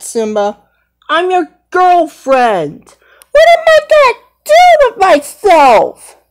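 high, rising to a tearful wail; upset girl lion, crying. Pretend. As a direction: build from hurt into a sobbing cry. Nala upset with Simba.

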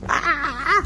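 high wavering "ah"; pretend shriek. Player fighting Spotty in a game, high shriek. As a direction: squeal as if attacked.

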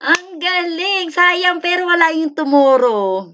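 high, loud, impressed exclamation; thrilled and loud, stretching the praise; excited praise. Real, not acted. Praises a viewer's nearly correct guess.

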